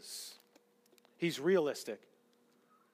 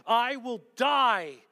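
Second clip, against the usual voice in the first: Peter's boast; 5 semitones higher, 5 dB louder, slower than usual. Acted. Peter's boast voiced by preacher.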